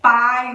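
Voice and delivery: pai said loud, the a strong and the i light; loud stressed A. Shown as a demonstration. Teacher loudly stressing the A in pai. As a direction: punch the a in pai. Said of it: "o A, a gente fala forte".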